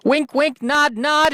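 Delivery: high, teasing wink-wink voice; high mock-coy innuendo; sly innuendo. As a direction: nudge the listener, high and sly. Bawdy innuendo aside.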